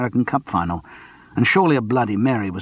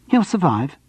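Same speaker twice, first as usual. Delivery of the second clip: higher, brisk dismissal; 7 semitones higher than usual. A narrator's reading. Sandra cuts off talk of her son, brisk. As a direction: shut the topic down quickly. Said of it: "replied briskly".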